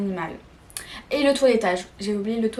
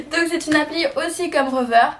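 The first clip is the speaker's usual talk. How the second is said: laughing; 5 semitones higher, 4 dB louder than usual. Real, not acted. Resuming after giggling at own accent.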